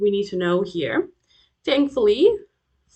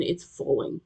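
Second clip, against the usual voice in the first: "falling" dropped low and softer; falling intonation; 5 semitones lower, 5 dB quieter than usual. Shown as a demonstration. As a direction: let the pitch sink down through "falling". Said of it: "points in the opposite direction".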